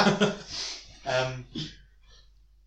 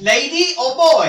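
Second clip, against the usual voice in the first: mock game-show announcer; 9 semitones higher, 10 dB louder than usual. Naming a mock quiz game.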